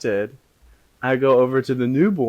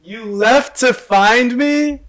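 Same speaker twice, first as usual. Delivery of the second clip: much higher, louder, slow astonishment; surprised disbelief; 11 semitones higher, 9 dB louder, much slower than usual. Real, not acted. Player hearing her friend left to find her, astonished. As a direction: nearly spit out your drink. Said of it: "spit take".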